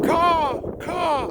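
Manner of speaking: high cawing like a crow; crow caw imitation. Talking to a bird overhead, mock crow caws. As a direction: caw back at the bird in its language. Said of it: "Don't worry, I speak the language".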